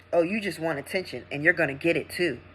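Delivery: low, soft, offhand put-down; dismissive doctor. Retold or imitated. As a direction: imitate a doctor brushing the patient off. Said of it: "that's how he said it to me".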